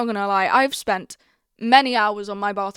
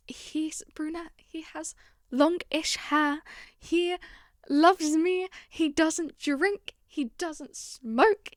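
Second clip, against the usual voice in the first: high, soft, drawn-out recital; dreamy wish-list recital; 6 semitones higher, 3 dB quieter, slower than usual. Retold or imitated. Vlogger reciting what she wrote about her dream boyfriend, dreamy. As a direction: tick off each wish slowly, high and soft.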